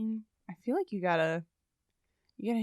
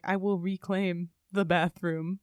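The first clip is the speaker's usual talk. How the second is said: mock solemn warrior; 4 semitones lower, slower than usual. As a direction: intone it like a hero before battle, breaking into a laugh at the end. Acts out a warrior's mock vow.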